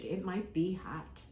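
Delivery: ordinary delivery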